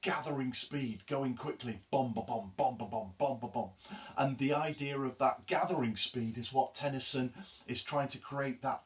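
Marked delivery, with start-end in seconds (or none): 1.9-3.7 "bom, ba, bom" chanted like hooves; galloping hoof rhythm chant. Shown as a demonstration. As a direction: beat out a horse's gallop. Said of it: "rhythm of a horse riding".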